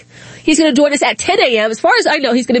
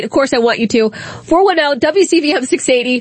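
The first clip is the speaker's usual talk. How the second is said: ordinary talk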